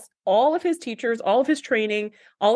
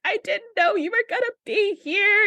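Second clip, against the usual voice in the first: much higher, moved, lightly imitated; 11 semitones higher than usual. [name] to her old music teacher, high and emotional. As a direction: squeak it out as if overwhelmed. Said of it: "And she's like".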